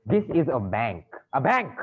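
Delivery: "bank" punched in exasperation; exasperated emphasis. Acted. Exasperated banker corrects the petitioner.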